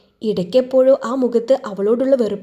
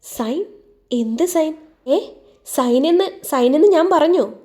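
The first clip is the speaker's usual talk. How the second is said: higher-pitched innocent questioning; feigned ignorance; 6 semitones higher than usual. A narrator's reading. Husband covers his slip about signing, feigning ignorance. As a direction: play dumb, as if puzzled. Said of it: "ഒന്നും അറിയാതെ പോലെ ചോദിച്ചു".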